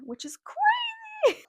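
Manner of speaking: very high, drawn-out, loud aside; playfully overdone squeal of disbelief; exaggerated amazement. Own comment on eerie plot.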